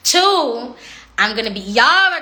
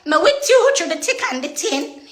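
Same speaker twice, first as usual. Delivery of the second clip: lightly raised, sweet lash voice; lashes' voice; 7 semitones higher than usual. Pretend. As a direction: voice the lashes like a loyal friend, a little higher. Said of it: "Them lashes say".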